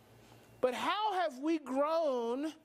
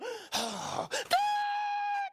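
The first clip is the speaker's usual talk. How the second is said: sharp gasp into a high siren squeal; alarm gasp. Shown as a demonstration. Amygdala spotting a threat, gasp and high alarm noise. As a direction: imitate an alarm going off. As soon as it sees one.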